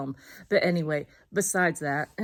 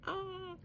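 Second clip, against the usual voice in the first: excited squeal; 13 semitones higher, 13 dB quieter than usual. Real, not acted. Excited about getting charms.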